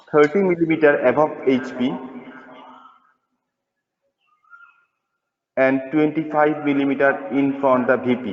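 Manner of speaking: slow, word-by-word dictating teacher; slow dictation. Acted. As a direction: spell it out slowly for note-taking.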